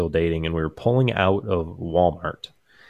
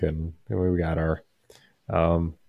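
normal talking voice

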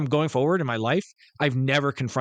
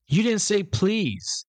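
high, slow mock girl's voice; retold cheeky girl; 9 semitones higher, much slower than usual. Girl answering back in the cinema, high-pitched and cheeky. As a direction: mimic a kid's pert comeback. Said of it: "and the girl goes".

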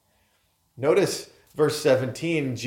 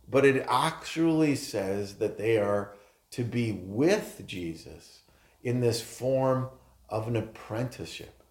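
ordinary talk, nothing marked

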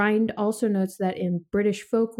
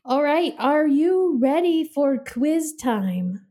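game show host; 6 semitones higher, 3 dB louder, slower than usual. Acted. Announcing the quiz game.

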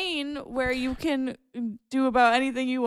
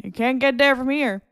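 louder put-on Maine accent; 6 dB louder than usual. Shown as a demonstration. Demonstrating the accent for friends, Maine accent. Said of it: "this is my Maine accent".

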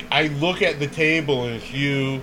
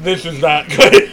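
laughing quote; 2 semitones higher, 8 dB louder than usual. Retold or imitated. Laughing while quoting herself.

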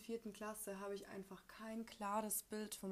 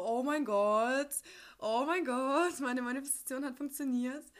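excited; 4 semitones higher, 10 dB louder than usual. Retold or imitated. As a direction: gush with disbelief at the gift. Überraschtes Geschenk der Mutter. (laut und begeistert ausgerufen)

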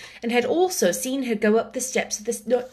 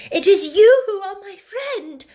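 much higher and louder, overflowing feeling; emotional repeat; 11 semitones higher, 7 dB louder than usual. Acted. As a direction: repeat it with all your heart. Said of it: "she said".

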